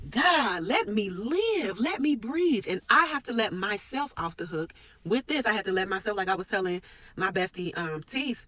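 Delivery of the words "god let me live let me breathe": drawn-out, pleading cry for some room; fed up, stretching the words out; exasperated plea. Real, not acted. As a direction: plead to be left to breathe. Voices exasperation at lifelong pressure.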